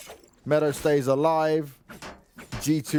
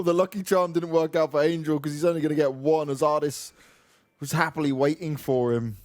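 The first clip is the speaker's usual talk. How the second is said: ordinary talk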